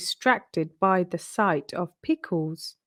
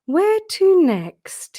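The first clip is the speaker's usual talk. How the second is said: high, slow and drawn out, puzzled little rabbit; wondering Peter; 10 semitones higher, 7 dB louder, much slower than usual. Acted. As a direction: let the question hang, slow and high. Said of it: "he wondered".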